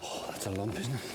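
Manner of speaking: breathless and quiet; winded after the fight; out of breath. Real, not acted. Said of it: "I am so out of breath".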